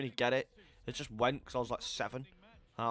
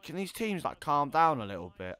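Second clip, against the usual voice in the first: normal talking voice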